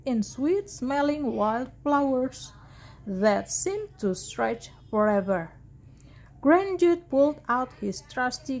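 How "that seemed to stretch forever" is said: ordinary delivery, nothing marked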